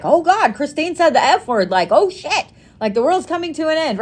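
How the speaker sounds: high, hammed-up gasp of disbelief; playfully acting scandalised by a friend's swearing; mock shock. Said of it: "we're all like".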